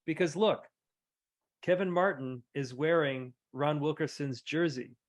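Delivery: ordinary talk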